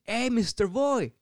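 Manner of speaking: high, drawn-out call to a star. Retold or imitated. Coworker hails the actor arriving on set, calling out. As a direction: imitate an eager fan hailing someone.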